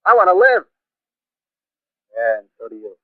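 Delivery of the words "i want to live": I want to live, cried out high and strong; fierce declaration. Acted. Artilleryman tells the stranger he wants to live, fierce and raised. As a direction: throw the line up with fierce hunger.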